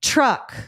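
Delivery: "Truck." with uh in the middle; substituted vowel. Shown as a demonstration. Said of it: "Substitute the a sound with an uh sound".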